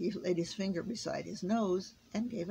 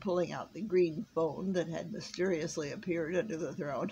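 plain reading voice

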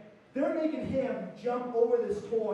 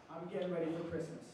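ordinary talk

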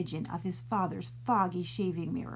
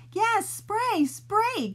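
high, bright eager calls; eager cry; 12 semitones higher, 4 dB louder, much slower than usual. Acted. Mom and sister eagerly urging the spray. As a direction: cheer the idea on. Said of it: "cried out Mom and Emily".

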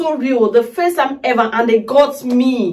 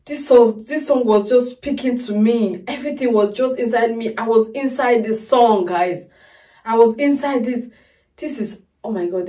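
ordinary talk

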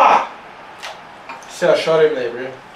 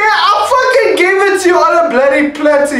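angry rant; 11 semitones higher, 5 dB louder, faster than usual. Real, not acted. Angry at teammate.

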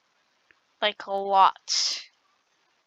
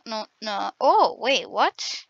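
usual speaking voice

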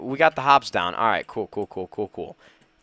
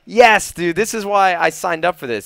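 loud, higher-pitched delight; excited cheer; 4 semitones higher, 6 dB louder than usual. Real, not acted. Gamer thrilled by the big jump down, loud excited cheer. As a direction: burst out thrilled.

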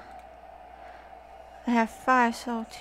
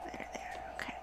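mock soothing whisper; 16 dB quieter than usual. Mock soothing a game mob.

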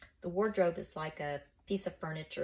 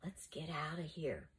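soft, half-whispered murmur; 8 dB quieter than usual. A narrator's reading. Flavius wants to flee the room, murmuring. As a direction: murmur it very quietly. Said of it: "Flavius murmured".

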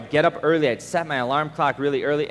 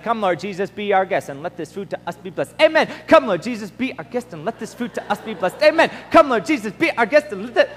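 gabbled faster and faster, words blurring into sound; rattled-off rote prayer; 3 semitones higher than usual. Retold or imitated. Preacher mimicking childhood mealtime prayer, rushed and mindless. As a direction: rattle it off mindlessly, repeating it like a chant. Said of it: "I didn't even know what I was saying".

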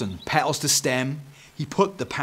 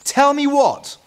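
very high, loud mum demanding answers; mother's voice; 9 dB louder, slower than usual. A narrator's reading. Mother overhears the children arguing, high loud demand. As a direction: call out sharply as Mum. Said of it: "came the voice of their mother".

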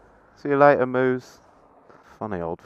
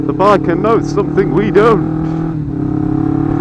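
loud, slow trailer-style announcement; booming mock epic voiceover; dramatic movie voice; 4 semitones higher, 12 dB louder than usual. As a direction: say it like an action movie narrator.

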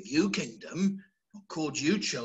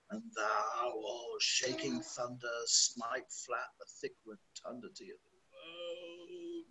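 much softer, low, brooding curse; hushed menace; 3 semitones lower, 13 dB quieter than usual. Acted. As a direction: pull it right down to a threatening hush. Lear's speech drops low.

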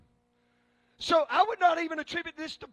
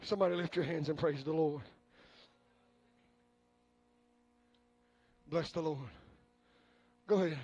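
quiet urging; 8 semitones lower, 7 dB quieter than usual. Acted. Calm urging.

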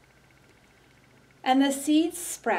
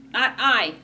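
quacking duck voice; 3 semitones higher, 6 dB louder than usual. Acted. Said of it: "quacked the duck".

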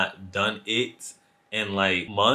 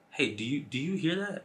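very soft, wary question; hushed alarm; 12 dB quieter than usual. Acted. Storyteller asking the date about footsteps, hushed and wary. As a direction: ask under your breath, as if someone might hear.

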